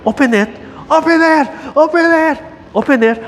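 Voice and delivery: put-on high, loud insistent child's chant; toddler demanding. Grandpa imitating toddler's relentless demand, high and loud. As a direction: keep demanding like a stubborn toddler. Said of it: "I'm standing there".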